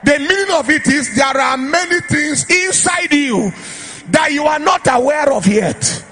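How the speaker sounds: loud, high-pitched prophetic declaration; full-force preaching. Real, not acted. Preacher prophesying to the congregation, full-force declaration. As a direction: proclaim it over a crowd, ringing and emphatic.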